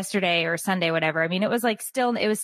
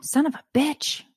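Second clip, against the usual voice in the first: retold scream; 9 semitones higher than usual.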